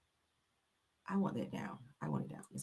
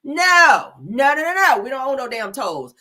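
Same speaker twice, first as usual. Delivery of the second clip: loud emphatic no; 5 semitones higher, 6 dB louder, slower than usual. Real, not acted. Emphatic denial to viewers.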